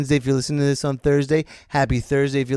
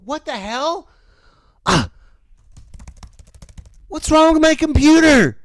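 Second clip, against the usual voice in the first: mock panicked coworker; 10 semitones higher, 3 dB louder than usual. Imitating coworker's computer crashing.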